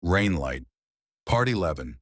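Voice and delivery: ordinary delivery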